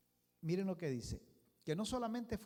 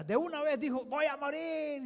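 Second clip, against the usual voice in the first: loud exclamation; 10 semitones higher, 8 dB louder than usual. Acted. Preacher mocking quick belief.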